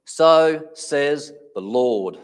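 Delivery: loud, very slow, solemn declaration; weighty, drawn-out pronouncement; prophet's proclamation. Retold or imitated. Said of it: "The word of the prophet was".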